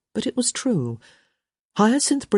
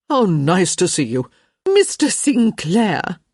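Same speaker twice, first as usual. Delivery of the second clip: gushing society hostess, higher on the name; effusive; 3 semitones higher, 5 dB louder, slower than usual. A narrator's reading. Hostess greeting a gentleman guest, effusive. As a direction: draw out the name with loud delight. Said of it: "Lady Plainsworth said effusively".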